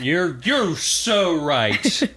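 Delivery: high, slow, hammed-up gushing; mock gushing agreement. Cohost riffing on Kaladin's reply, mock gushing. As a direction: overplay the agreement, teasing.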